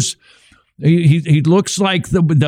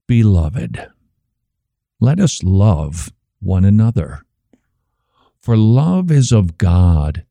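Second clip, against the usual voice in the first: slow, lower, lingering scripture reading; tender scripture reading; 5 semitones lower, slower than usual. Retold or imitated. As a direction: read each phrase slowly as if every word matters. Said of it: "Reading it with a tone that communicates".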